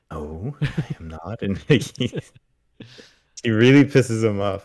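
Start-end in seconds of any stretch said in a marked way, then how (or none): 0.1-1.4 slow, softer, holding in annoyance after a deep breath; mock calm through gritted teeth; restrained irritation. Dan forcing calm at the receptionist, slow and soft. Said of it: "He takes a deep breath".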